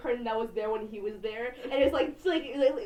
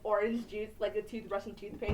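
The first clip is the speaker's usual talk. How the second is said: ordinary delivery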